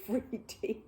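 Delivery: plain reading voice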